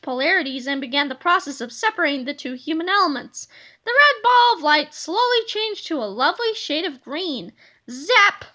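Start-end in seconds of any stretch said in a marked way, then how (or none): none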